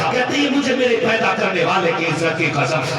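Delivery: loud, high, impassioned sermon delivery; full-force preaching. Acted. Preacher builds the mother's rebuke into a climax, impassioned. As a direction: preach it at the top of your voice.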